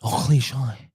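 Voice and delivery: put-on French pronunciation of "cliche"; mock French accent. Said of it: "or as the French say".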